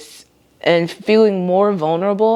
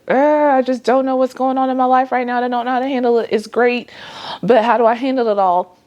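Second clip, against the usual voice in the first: higher, fast, flustered voice; overwhelmed self; 7 semitones higher, faster than usual. Retold or imitated. To herself about life changes, a higher, fast flustered voice. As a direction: rattle it off high like she's overwhelmed. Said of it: "I may feel like".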